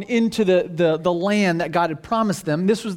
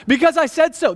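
higher-pitched, fed-up parental comeback; exasperated parent; 6 semitones higher, 3 dB louder than usual. Acted. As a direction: shut down the questioning child. Parent's exasperated answer to "why.

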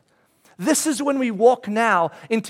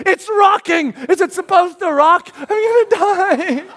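terrified shout; 10 semitones higher, 7 dB louder, slower than usual. Retold or imitated. Terror at coaster top.

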